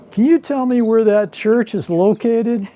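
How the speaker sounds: high Southern lady voice, innocently asking; little old Southern lady. Retold or imitated. Southern lady mistaking veganism for a church, high old-lady voice. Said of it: "a little old Southern lady".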